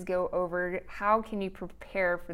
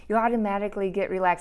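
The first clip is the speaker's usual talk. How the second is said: ordinary delivery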